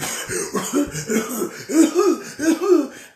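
a real high laugh. Real, not acted. Preacher laughing at his own story. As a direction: laugh at the memory.

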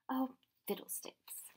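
muttered annoyance. A narrator's reading. Professor's trousers caught in chain.

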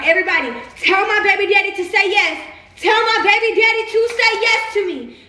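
loud pleading. Real, not acted. Woman rallying the crowd behind her proposal, loud pleading. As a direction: call it out, begging everyone to help.

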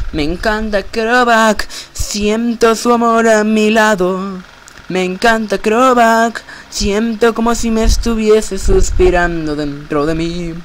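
high, slow swooning voice; lovestruck voice. Acted. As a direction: swoon over him dreamily. Playing lovestruck character.

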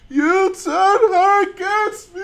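loud, high, slow cry of betrayal; hammed-up wail, every word drawn out; anguished accusation. Streamer mimics a film confrontation, anguished shouted accusation.

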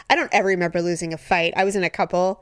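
usual speaking voice

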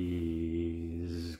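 low, soft, drawn-out plea; quiet mock-polite shark; grinning shark. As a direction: drop down into a sly wheedle. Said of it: "grinned the shark".

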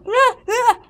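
high, loud "ah, ah"; two short high cries; startled cries. Acted. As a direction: yelp twice in alarm. Startled cries in acted skit.

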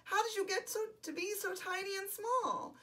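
high storybook wail, mildly done; bawling. A narrator's reading. Bear to tiny teddy, mildly bawling. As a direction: wail it high, half in earnest. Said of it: "could hear the voice bawl".

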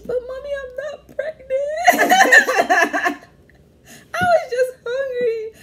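high, teary laughing whine; mock crying plea. Imitating herself crying to mom.

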